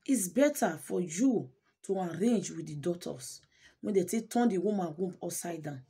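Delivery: ordinary delivery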